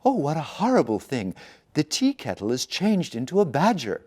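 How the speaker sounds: high, loud cry of fright; frightened cry. Acted. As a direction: cry out in horror at the sight. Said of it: "He cried out".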